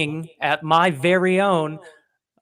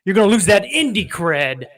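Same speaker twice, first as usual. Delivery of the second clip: high and louder playful caution; mock inner warning; 4 semitones higher, 6 dB louder than usual. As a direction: warn yourself with a teasing lift. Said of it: "and then I'm like".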